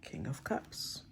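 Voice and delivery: slow soft whisper. Real, not acted. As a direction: whisper the card name slowly. Reading out a drawn tarot card.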